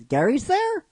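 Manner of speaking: very high, incredulous question; surprised question. Acted. She hears Gary is there, surprised and very high. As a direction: leap up in pitch, disbelieving. Acts her surprise at the news.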